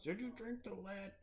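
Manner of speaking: a fan asking the Flint joke question, mock-curious. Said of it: "Everyone always asks".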